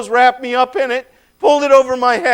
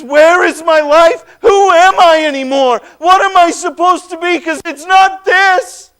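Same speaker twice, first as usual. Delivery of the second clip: crying out high and loud, close to tears; a tormented man howling his despair; anguished cry; 8 semitones higher, 7 dB louder than usual. Acted. Tormented man cries out in anguish.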